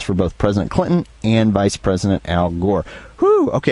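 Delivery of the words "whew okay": winded exhale after a long read; out of breath. Real, not acted. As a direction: puff it out, short of breath. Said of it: "I'm out of breath".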